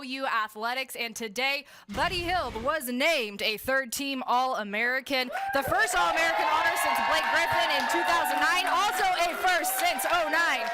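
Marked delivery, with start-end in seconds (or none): 5.5-10.7 excited highlight call. Real, not acted. Anchor hyping a record honour.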